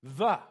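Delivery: "the" said alone, loud and punched; emphatic "the". Shown as a demonstration. Preacher singles out Peter's key word, "the" said loud and emphatic. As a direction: set "the" apart with full force. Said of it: "There's that word again".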